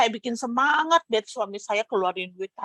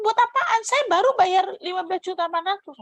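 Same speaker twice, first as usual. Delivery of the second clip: high, loud, fast disbelief; the mother blurting out that she just paid, much higher and louder; shocked mother; 9 semitones higher, 4 dB louder, faster than usual. Retold or imitated. Mother balking at lending more money, shocked high and loud. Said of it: "kata mama saya".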